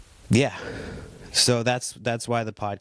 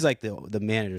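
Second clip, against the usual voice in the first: ordinary delivery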